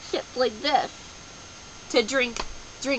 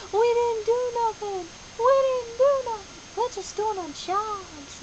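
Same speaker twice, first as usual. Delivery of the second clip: high, put-on whiny workers protesting; whiny construction workers; 11 semitones higher than usual. Voicing construction workers, whining that they did nothing wrong. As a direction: play the workers as pitifully defensive.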